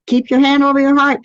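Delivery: ordinary talk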